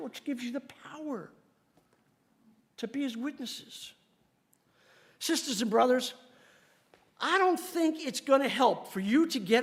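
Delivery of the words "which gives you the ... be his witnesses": soft, whispered aside; hushed preacher's whisper. Acted. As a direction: breathe it out in a whisper. Describing Holy Spirit's power.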